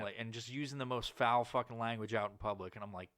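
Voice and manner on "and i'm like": normal talking voice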